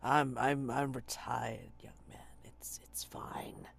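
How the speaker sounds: put-on hushed, weary old sailor; old man voice. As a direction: whisper it like a tired old officer. GM voices old retired lieutenant.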